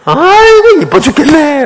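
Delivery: put-on high, gushing coo at a cute baby; gushing over a baby. Preacher imitating a woman cooing over a cute baby, high and gushing. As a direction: coo it like an auntie seeing a baby. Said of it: "길 가다가 아가 이뻐도 죽어요".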